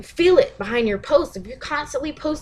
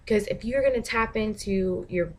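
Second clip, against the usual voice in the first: ordinary delivery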